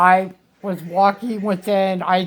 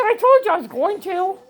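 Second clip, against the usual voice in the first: triumphant reply; 10 semitones higher than usual. Retold or imitated. Patient retelling her answer to the surgeon, triumphant. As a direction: crow it back proudly.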